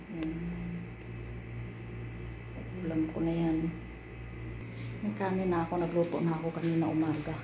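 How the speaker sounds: soft thinking-aloud murmur. Real, not acted. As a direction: mumble it to yourself. Thinking quietly about dinner.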